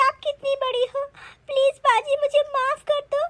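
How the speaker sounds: high, weepy self-reproach; tearful crying. Acted. Daughter-in-law confessing to mother-in-law how badly she behaved, crying. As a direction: beg forgiveness through tears. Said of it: "बहू तू रो मत बहू".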